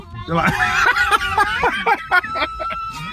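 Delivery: a long, high laugh breaking out; real high laugh at the joke; high burst of laughter. Real, not acted. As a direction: laugh hard at your own joke.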